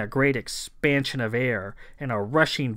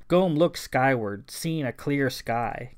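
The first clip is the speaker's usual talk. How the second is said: plain reading voice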